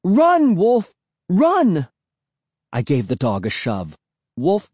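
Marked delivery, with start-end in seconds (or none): 0.0-1.9 high, loud desperate shout; urgent high-pitched yell. Acted. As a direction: shout a frantic warning, pitched way up. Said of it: "I shouted".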